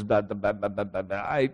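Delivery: mock a timid stutter, voice dropped low and soft; stammering Moses. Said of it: "And Moses says".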